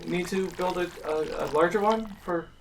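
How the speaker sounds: a meek little slime host, unsure; lightly voiced slime teacher.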